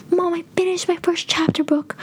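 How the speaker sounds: put-on high, breathy child's excitement; excited kid. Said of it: "and I was like".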